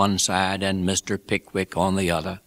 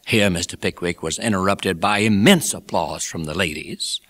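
plain reading voice

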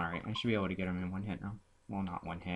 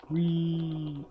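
high, louder drawn-out whee; playful whee; 9 semitones higher, 5 dB louder than usual. Pretend. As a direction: squeal a long playful whee. Playful cheer during the game.

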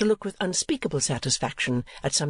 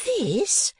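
high-voiced chattering portrait painter; Miss La Creevy; 10 semitones higher than usual. A narrator's reading. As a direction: lift into a high, prim, gossipy voice.

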